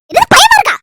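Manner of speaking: very high, loud scolding; exasperated shout. Acted. Daughter scolds her hiding mother, high and loud. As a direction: snap at her in disbelief.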